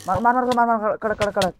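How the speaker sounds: high, loud frantic urging; cheering a teammate on, high and loud; excited shouting. Real, not acted. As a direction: hammer out the words as the fight peaks. Urging a kill in fight.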